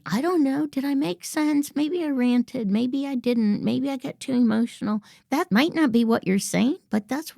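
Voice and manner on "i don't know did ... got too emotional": higher, anxious self-doubting run; insecure rambling. Shown as a demonstration. Coach mimics an insecure speaker, anxious and higher. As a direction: fret over yourself. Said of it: "Not saying".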